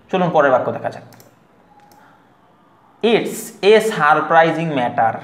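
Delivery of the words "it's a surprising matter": slow Bangladeshi English, "surprising matter" with both r's sounded; Bangladeshi-style "It's a surprising matter", article as ay, plain t; Bangladeshi pronunciation. Shown as a demonstration. Teacher showing how we say it, Bangladeshi English pronunciation. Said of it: "এই হচ্ছে আমাদের উচ্চারণ".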